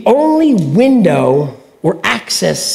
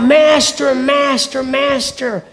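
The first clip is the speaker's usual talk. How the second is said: panicked cries; 5 semitones higher, slower than usual. Retold or imitated. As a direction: call master, then trail off scared. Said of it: "he cries out".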